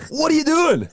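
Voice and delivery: very high, loud incredulous cry; mock outrage. Friend scolding someone choosing KFC in food court, high mock outrage. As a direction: squawk it out in comic disbelief.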